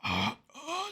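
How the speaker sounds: mock decrepit groaning, pitch leaping high; old wheezing groan. Reactor mocks Chris Christie as aged before his time, decrepit groans. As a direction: imitate a worn-out old man groaning. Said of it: "but he's already like".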